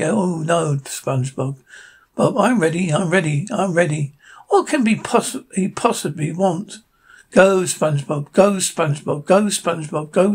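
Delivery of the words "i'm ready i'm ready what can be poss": plain reading voice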